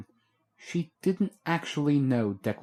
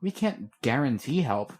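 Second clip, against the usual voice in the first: usual speaking voice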